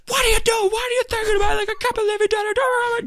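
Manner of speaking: high-pitched mock scolding yell; retold screaming. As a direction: imitate a comedian ranting at a kid. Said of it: "he's screaming at him".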